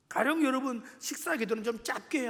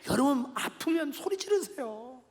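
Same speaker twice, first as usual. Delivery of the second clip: normal talking voice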